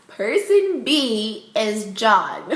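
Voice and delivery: slow, drawn-out announcement through laughter; laughing reveal. Real, not acted. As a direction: savour the big reveal, laughing.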